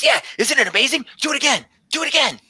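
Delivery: very high, breathless delight; excited tabaxi druid. Acted. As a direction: gush like a wide-eyed scholar. Druid urges tapping the jar.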